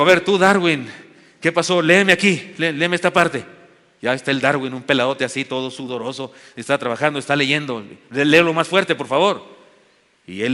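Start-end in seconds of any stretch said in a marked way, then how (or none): 0.0-3.4 loud commanding call to a worker. Retold or imitated. Woman calls out workers to read. 8.1-9.4 loud push to read louder; loud order. Retold or imitated.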